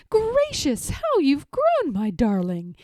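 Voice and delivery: high, loud, gushing mother's greeting; doting mother. A narrator's reading. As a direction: swoop up like a delighted mother. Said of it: "she says as she kisses him".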